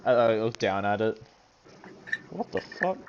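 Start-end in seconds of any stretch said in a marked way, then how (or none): 2.3-3.0 soft, higher-pitched shock; hushed disbelief. Acted. Looking down at the journal page, hushed shock. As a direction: say it under the breath, stunned.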